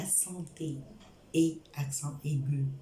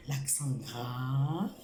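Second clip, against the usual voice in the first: slow model word; 3 semitones lower, much slower than usual. Shown as a demonstration. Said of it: "teacher repeats slowly".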